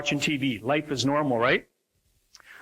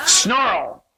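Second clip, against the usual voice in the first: loud, high burst of mock anger; hammed-up angry outburst; mock snarl; 6 semitones higher, 8 dB louder than usual. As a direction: snarl the word out suddenly. Acting out an angry older daughter.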